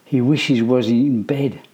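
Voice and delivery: usual speaking voice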